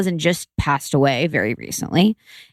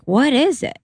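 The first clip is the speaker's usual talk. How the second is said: confused; 8 semitones higher than usual. Retold or imitated. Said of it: "I was, like, so confused".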